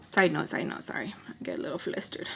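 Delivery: fast hushed whisper; whispered aside. Real, not acted. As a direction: mutter it, embarrassed.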